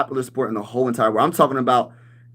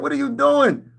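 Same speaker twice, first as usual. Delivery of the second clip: much higher exasperated yell; screaming at the QB; 11 semitones higher than usual. Retold or imitated. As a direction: yell it in disbelief, pitched way up. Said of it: "I be screaming that".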